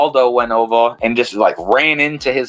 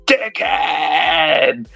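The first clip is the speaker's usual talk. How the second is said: drawn-out mock yell, higher but held back; mock scream; 4 semitones higher than usual. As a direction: imitate screaming without full volume. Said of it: "we were just screaming".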